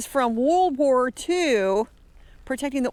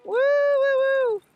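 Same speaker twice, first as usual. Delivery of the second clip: high, gleeful woo calls; excited cheering; 12 semitones higher than usual. Real, not acted. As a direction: cheer them on brightly. Cheering while greeting the kids.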